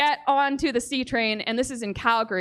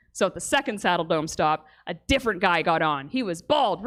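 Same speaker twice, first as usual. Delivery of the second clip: usual speaking voice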